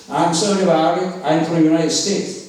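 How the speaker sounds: very slow, careful American English accent. Shown as a demonstration. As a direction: spell each word out in an American English accent. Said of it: "이렇게 영어식으로 해야 돼".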